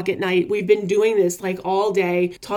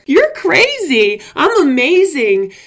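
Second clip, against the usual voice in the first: louder, higher defiant comeback; defiant retort; 4 semitones higher, 6 dB louder than usual. Retold or imitated. What I wish I'd told the counselor, a defiant loud retort. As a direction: tell the counselor off. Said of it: "to say to that guidance counselor".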